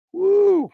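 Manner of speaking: high, loud "woo"; excited whoop. Real, not acted. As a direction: let out a big high whoop. Host lets out a whoop.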